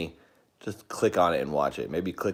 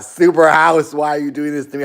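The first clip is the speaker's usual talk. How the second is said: mock despair; 10 semitones higher, 10 dB louder than usual. Laments to house after screams.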